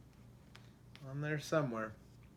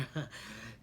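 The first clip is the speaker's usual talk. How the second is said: breathy laugh; 8 dB quieter than usual. Real, not acted. Reader stumbles and laughs.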